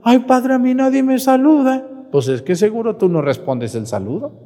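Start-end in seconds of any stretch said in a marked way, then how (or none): 0.0-1.9 high, whiny mock parishioner lament; whiny complaint. Parishioner complaining to the priest, mock whiny. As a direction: moan it like someone feeling ignored.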